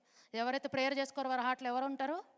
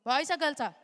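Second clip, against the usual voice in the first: rallying call; 2 semitones higher, 9 dB louder than usual. Real, not acted. Teacher rallying kids to shout.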